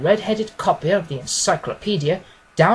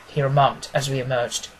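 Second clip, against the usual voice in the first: plain reading voice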